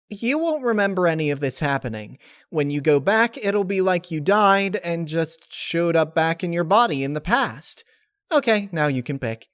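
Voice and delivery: high, eager explanation; high cheerful spirit voice; chirpy Laughter voice. Acted. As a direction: rush through the rules happily.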